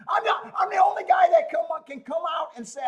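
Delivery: fast, loud, half-laughing boast; a sudden, quick burst of fired-up bragging; excited shout. Real, not acted. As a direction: blurt it out with glee. Boasting, worked up on stream.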